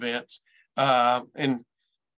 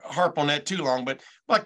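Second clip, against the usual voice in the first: ordinary delivery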